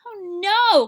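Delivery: high, loud alarmed cry; frightened cry. Acted. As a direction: cry out in sudden fear. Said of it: "cried the deer".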